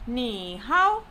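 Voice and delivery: scoop "Ni hao" down and up; slow demonstration of dipping "Ni hao"; falling-rising tone. Shown as a demonstration. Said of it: "falling rising tone".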